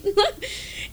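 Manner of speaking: a high, breathy giggle; a burst of giggling; a happy laugh. Real, not acted. Laugh after teasing.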